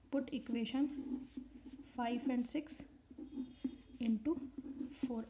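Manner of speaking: soft words spaced by long pauses; slow dictation. Real, not acted. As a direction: say it as you write it. Dictating while writing on board.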